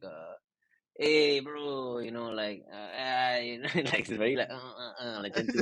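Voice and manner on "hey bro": a light, laid-back "Hey, bro", pitched a bit higher; casual Malaysian dude. Acted. Voicing the dog Waffles for the interviewer, easygoing bro greeting. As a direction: toss it off like a relaxed Malaysian buddy. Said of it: "His character is very, like".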